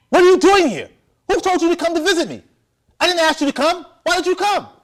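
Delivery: full-force high-pitched angry shout; furious yelling. Acted. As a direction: yell at an unwelcome visitor, demanding answers. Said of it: "and I yelled at her".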